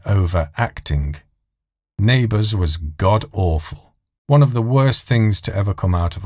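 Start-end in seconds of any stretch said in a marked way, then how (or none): none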